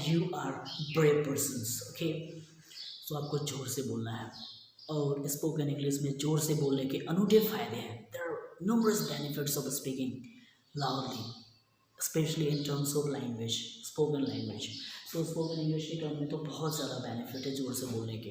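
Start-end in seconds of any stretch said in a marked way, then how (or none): none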